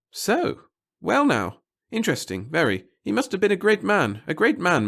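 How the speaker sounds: high, loud, fretful little man; fussy nervous clerk. A narrator's reading. The little clerk fretting to the reporter about his boss, high and anxious. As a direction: babble anxiously to please the reporter. Pettigill impressed.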